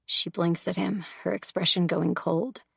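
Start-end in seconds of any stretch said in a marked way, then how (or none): none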